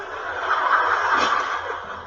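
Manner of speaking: soft laugh. Real, not acted. Laugh after the punchline.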